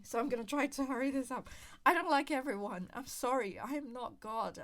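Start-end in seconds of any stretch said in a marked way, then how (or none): 0.0-1.5 hurried. Real, not acted. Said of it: "My recorder is really dying". 1.8-2.9 much louder, insisting. Real, not acted. Repeats her point much louder.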